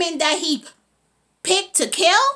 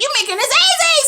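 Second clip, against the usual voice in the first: high-pitched gloating call; villain's taunt; 14 semitones higher, 7 dB louder than usual. Retold or imitated. Villain to the woman he attacks, high taunting cry. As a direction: crow it out high. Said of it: "He's like".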